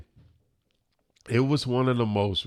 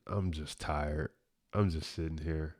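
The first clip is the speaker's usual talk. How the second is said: tired, deflated; 5 semitones lower, 9 dB quieter than usual. Retold or imitated. Man admitting to his cousin he's just tired, deflated. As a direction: sound drained, voice sinking low and quiet. Recounts being worn out in car.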